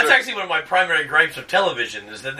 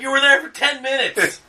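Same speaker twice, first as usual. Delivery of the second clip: incredulous; 12 semitones higher, 6 dB louder than usual. Retold or imitated. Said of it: "And you're like".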